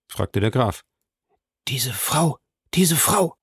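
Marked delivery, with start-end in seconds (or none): 1.7-3.4 hushed first, then rising in pitch; aghast recognition. A narrator's reading. Bertuccio spots the woman among the guests, aghast. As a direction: stammer it out in mounting shock.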